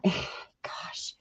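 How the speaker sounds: a whispered, sighing gosh; frustrated whisper. Real, not acted. Streamer to chat, sighing in frustration, whispered. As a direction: breathe the words out under your breath, fed up. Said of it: "I get very frustrated".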